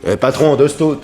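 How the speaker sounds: high, loud call to the barman. Acted. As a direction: hail the bartender across the room. Calling to barman across bar.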